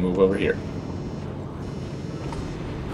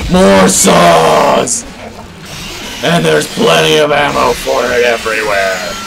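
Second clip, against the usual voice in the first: gleeful shout; 3 semitones higher, 4 dB louder than usual. Real, not acted. Gleeful at finding weapons.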